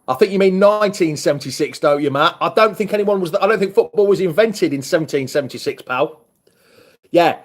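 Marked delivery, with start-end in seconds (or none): none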